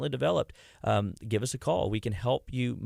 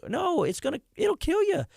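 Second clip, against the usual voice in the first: incredulous. Real, not acted. Rebutting the mocked view.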